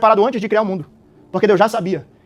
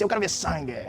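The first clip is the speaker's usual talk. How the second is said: bloodthirsty accuser; 4 semitones lower, 12 dB quieter than usual. Acted. Preacher voices a bloodthirsty accuser.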